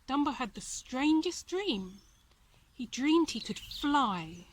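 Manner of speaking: plain reading voice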